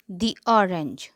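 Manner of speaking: "the" said "thee" before "orange"; long ee in "the", then "orange". Shown as a demonstration. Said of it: "इसे दी बोला जाता है". ("the" को "दी" बोलें)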